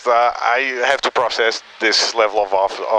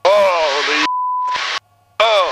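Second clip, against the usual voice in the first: excited; 7 semitones higher, much slower than usual. Real, not acted. Pilot thrilled during steep climb.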